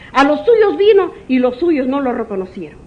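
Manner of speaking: spoken out firmly as the Lord's words; proclaimed quote. Acted. Preacher quoting the Lord to his congregation, proclaimed. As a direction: announce it to the church.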